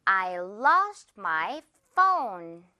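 I lost my phone spoken word by word, very slowly; slow classroom model sentence; very slow demo. Shown as a demonstration. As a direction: spell out each word slowly for the class to repeat. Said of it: "One more time".

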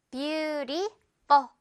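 very slow beautiful, ful light. Shown as a demonstration. Teacher drilling beautiful slowly. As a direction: stretch beautiful out with a feather ful. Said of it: "full 하지 맙시다".

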